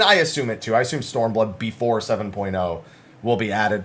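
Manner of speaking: ordinary talk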